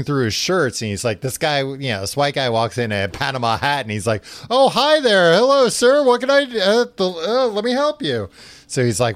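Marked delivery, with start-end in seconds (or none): 4.5-8.3 high, loud, fawning greeting; overly eager to please the stranger, voice pitched up; nervous over-politeness. Retold or imitated. Kinda nervous around this guy.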